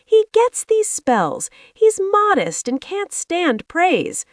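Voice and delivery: high, slow flustered reassurance; flustered farmer. A narrator's reading. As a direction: fluster out an excuse. Farmer flustered excusing fainted pig.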